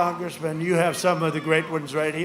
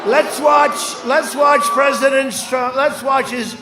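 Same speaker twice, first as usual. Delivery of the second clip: lightly voiced wife, higher and louder; raised, insistent playful quote; wife's eager plea; 5 semitones higher, 5 dB louder, slower than usual. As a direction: act out a wife pressing to watch TV. Wife voiced wanting the television.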